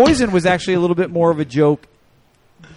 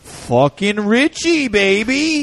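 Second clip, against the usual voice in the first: Jersey guy voice; 7 semitones higher, slower than usual. Pretend. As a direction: rant like a proud Jersey rocker. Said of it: "So, Jersey guy".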